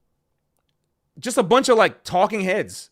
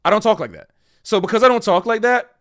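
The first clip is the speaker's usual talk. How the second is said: usual speaking voice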